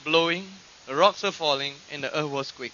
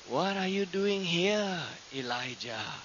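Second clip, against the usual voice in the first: soft, slow question, dropping low on the name; quiet divine voice; 3 semitones lower, 8 dB quieter than usual. Retold or imitated. God asking Elijah at the cave entrance, soft and slow. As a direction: ask it gently, without raising the voice. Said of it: "then that voice said".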